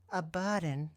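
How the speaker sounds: ordinary delivery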